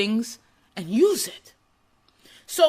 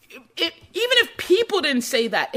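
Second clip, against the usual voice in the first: ordinary talk, nothing marked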